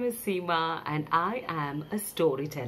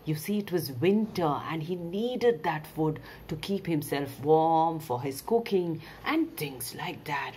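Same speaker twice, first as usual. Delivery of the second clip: usual speaking voice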